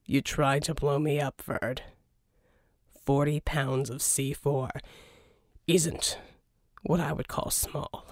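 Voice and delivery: underplay it, wry and cool; Jack voiced. Jack answering dryly.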